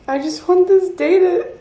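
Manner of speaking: high, tearful voice breaking into sobs; real crying, words pushed out through tears; choked-up birthday tears. Real, not acted. Crying alone on her birthday.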